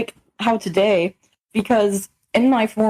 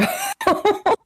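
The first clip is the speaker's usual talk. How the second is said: high-pitched burst of laughter; helpless high laugh; 12 semitones higher than usual. Real, not acted. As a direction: crack up at your own story. Laughs at her own story.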